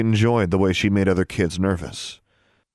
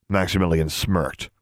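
ordinary delivery

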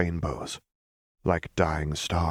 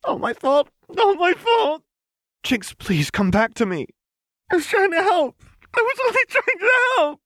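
high, loud, pleading cry; high-pitched loud wail, speeding up; desperate protest; 7 dB louder than usual. Acted. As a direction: defend yourself against the voices, frantic. Jinx arguing with voices.

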